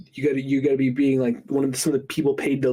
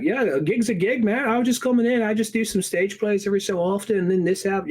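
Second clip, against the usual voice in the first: high, casual Aussie voice; breezy Australian impression; 6 semitones higher than usual. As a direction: play a laid-back Australian actor, pitched up. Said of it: "all these Australians being like".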